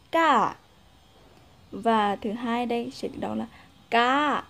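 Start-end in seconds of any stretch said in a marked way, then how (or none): 0.1-0.6 ca said high and loud; high pitched tone. Shown as a demonstration. Teacher showing the raised Lao tone on ca. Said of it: "cao lên". 3.9-4.4 ca said level but clearly high. Shown as a demonstration. Teacher showing the level high Lao tone. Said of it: "Ngang nhưng mà hẳn cao".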